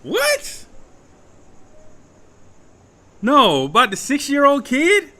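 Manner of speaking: sudden high-pitched, loud "what?"; rising in shock as it sinks in; shocked disbelief. Real, not acted. Realizing the kid was shot.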